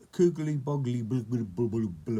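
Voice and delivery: loud, bubbling babble tumbling downward; nonsense spell. A narrator's reading. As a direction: burble a garbled magic word. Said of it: "said something which sounded like".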